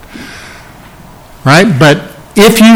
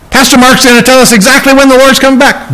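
higher and fast, imitating an eager listener; mock hopeful churchgoer; 8 semitones higher, faster than usual. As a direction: rattle it off like a naive fan of prophecy. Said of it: "if you've come saying".

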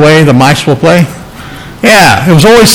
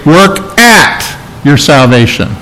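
AT punched out, then a short pause; single out "at" as the wrong word; stress on AT; 3 semitones lower than usual. Shown as a demonstration. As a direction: hit "at", pause, drop lower for the rest. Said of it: "He does not say".

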